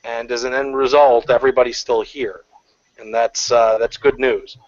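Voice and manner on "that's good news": normal talking voice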